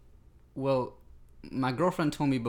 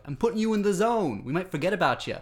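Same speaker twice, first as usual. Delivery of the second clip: put-on higher, louder Boston-accented teacher threat; 8 semitones higher, 5 dB louder than usual. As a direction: send a kid out in a Boston accent. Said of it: "impression of a teacher that I had".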